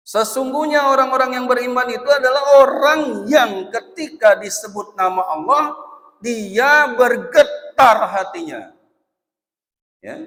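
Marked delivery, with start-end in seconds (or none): 0.1-8.8 loud, high, slowing preaching; preaching at full force. Acted. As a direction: thunder it out to the crowd. (ceramah lantang dan tinggi)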